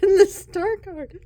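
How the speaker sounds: high, loud words squeezed out through laughter; helplessly laughing as you speak. Real, not acted. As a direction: talk while cracking up. Cracking up over the cards.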